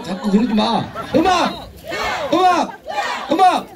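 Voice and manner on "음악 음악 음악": high, sharp call of 음악; calling out cue. Acted. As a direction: bark the cue up high, again and again. Repeated cues to band.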